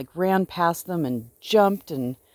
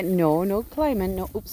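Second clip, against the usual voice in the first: normal talking voice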